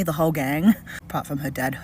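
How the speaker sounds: normal talking voice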